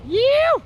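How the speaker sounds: loud, very high cheering whoop. Real, not acted. Cheering the truck through the snow, high whoop. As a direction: whoop at the top of your range.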